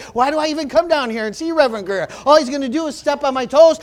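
mildly heated grumbling, retold; grumpy, mad. Churchgoer griping about Reverend Greer's sermon, mock grumpy. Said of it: "I was kinda grumpy and I was kinda mad".

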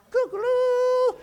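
high crowing cock-a-doodle call; rooster crow. Retold or imitated. Preacher crowing like a rooster at faith. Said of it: "And I crowed".

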